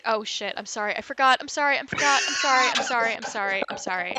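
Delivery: loud rapid-fire sorries, fading at the end; frantic apology. Real, not acted. Player missed bleeping a swear word, frantic apology. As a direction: blurt apologies over and over, then wind down.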